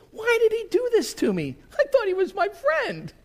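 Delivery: the sheep asks why its friend did this; high wounded sheep whine; betrayed sheep. Acted. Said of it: "You're thinking".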